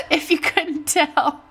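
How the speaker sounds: high, slow, laughing through emotion. Real, not acted. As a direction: laugh it out, touched and a little embarrassed. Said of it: "I'm really super emotional".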